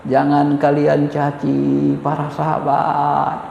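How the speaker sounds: imitating a calm cleric, unhurried; imam's calm sermon. A speaker voices a calm imam urging unity, slow and low.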